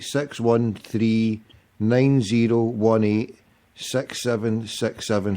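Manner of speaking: slow dictation. Real, not acted. Host reads his number slowly to callers. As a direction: spell out each digit slowly and clearly.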